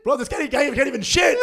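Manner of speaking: high-pitched, loud exasperation; outraged shouting. Acted. Cousin insisting the uncle is unfit, outraged shouting. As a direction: pitch it way up in disbelief.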